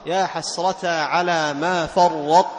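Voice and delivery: very slow, with the emphatic ط of "فرط" held heavy and pressed; Quranic recitation demo. Shown as a demonstration. Teacher showing students the ṭ-closure left in "فرطت", slow recitation. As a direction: stretch "فرط" so the ط's emphatic closure is heard. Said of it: "لاحظ هذا الإطباق".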